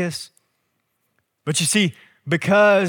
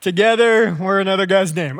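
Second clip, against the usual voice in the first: laughing confusion; 4 semitones higher, 3 dB louder than usual. Retold or imitated. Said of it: "I'm like".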